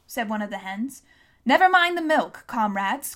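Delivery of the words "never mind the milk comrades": louder, higher dismissive call; raised leader's cry, dropping at the end; commanding cry. A narrator's reading. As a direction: cut off the question with a raised voice. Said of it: "cried Napoleon".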